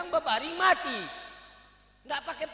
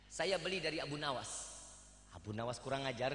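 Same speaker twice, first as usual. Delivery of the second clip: low, softer timid answer; meek reply; 11 semitones lower, 9 dB quieter, faster than usual. Acted. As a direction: answer small and cowed. Merchant answers meekly. (jawaban pelan dan takut)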